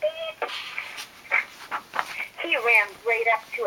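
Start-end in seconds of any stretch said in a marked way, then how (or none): 0.0-0.5 high soft beep. Acted. Reading the story, a high soft machine beep.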